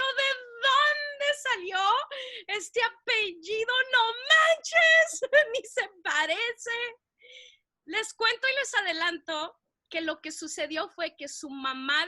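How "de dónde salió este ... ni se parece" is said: slow, high disbelief. Retold or imitated. Retold disbelief.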